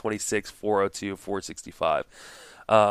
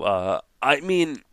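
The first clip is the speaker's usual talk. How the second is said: ordinary delivery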